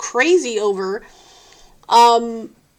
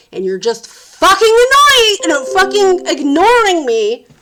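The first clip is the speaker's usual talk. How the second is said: loud, high, heated venting at the crew; angry rant; 4 semitones higher, 4 dB louder than usual. Real, not acted. Complaining about the film crew, loud and furious. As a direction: let the frustration boil over.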